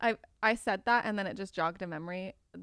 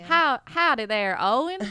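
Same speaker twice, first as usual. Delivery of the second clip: put-on Southern accent; 5 semitones higher, 8 dB louder than usual. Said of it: "How would you say it in a Southern accent?".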